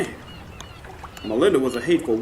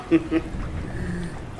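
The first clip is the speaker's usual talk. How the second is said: soft chuckle; 3 semitones higher, 5 dB quieter than usual. Acted. Old man laughs at dog.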